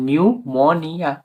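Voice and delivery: নিউমোনিয়া slowly as "niu-mo-nia"; pronunciation demo, silent P. Shown as a demonstration. Teacher answers how it is pronounced, slow নিউমোনিয়া. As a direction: draw out নিউমোনিয়া, starting on N. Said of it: "উচ্চারণ কি হবে?".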